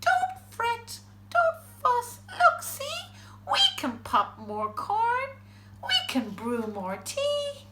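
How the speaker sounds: high little mouse voice; squeaking mouse. A narrator's reading. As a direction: squeak out comfort as a tiny mouse. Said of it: "Mouse squeaks".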